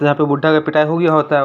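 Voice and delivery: ordinary delivery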